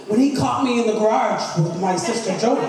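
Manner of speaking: ordinary delivery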